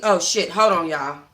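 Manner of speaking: normal talking voice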